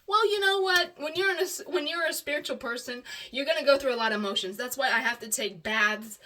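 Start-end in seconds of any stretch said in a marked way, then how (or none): 0.1-4.5 loud, higher, indignant comeback; defiant retort. Real, not acted. Rebutting partner's "you cry too much", defiant. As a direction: answer the criticism back with force.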